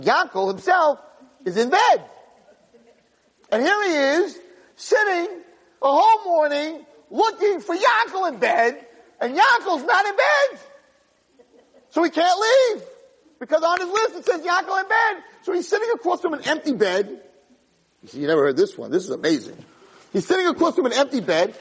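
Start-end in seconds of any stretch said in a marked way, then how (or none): 0.0-17.2 very high, strained exclaiming; exclaimed punchline. Retold or imitated. Teller reveals Yankel can't find himself, high-pitched exclaiming. 20.1-21.5 high-pitched incredulous retelling; exclaimed punchline. Retold or imitated. Teller repeats the boy's predicament, high-pitched and incredulous.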